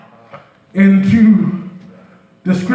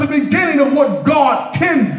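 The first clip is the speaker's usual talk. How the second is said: full-force preaching; 5 semitones higher than usual. Acted. Preacher lifting the church, full-force preaching. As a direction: preach it out to the congregation, rising and ringing.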